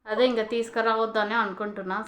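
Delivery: usual speaking voice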